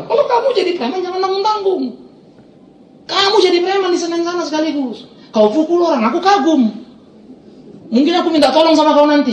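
high-pitched, ringing lecture; retold scolding. Pastor scolds the cowed thug.

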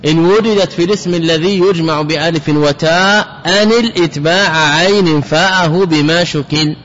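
normal talking voice

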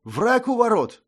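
high, urgent cry of alarm. Acted. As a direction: sound the alarm from the window. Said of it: "объявил адъютант".

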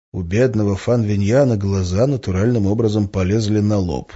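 plain reading voice

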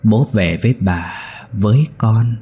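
put-on eerie, hollow child's voice calling father; ghostly child whisper. A narrator's reading. Dead son's ghost calling his father, eerie and hollow. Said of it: "kèm theo đó là tiếng thì thầm".

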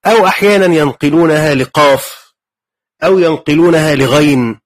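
ordinary talk

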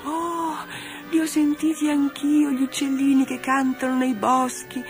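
high, thrilled little doll voice; delighted exclamation. Acted. Doll Dora thrilled to hear the woodland birds, high and delighted. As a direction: burst out with joy at the birds. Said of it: "esclamò Dora".

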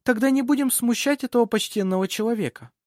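high, emphatic noblewoman voice; gracious hostess. Acted. Lady inviting travellers to visit tomorrow, gracious high voice.

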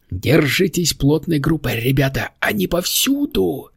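high-pitched little demon calling out; crying out. A narrator's reading. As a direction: sound the alarm in a high monster voice. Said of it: "вскричал Стикити".

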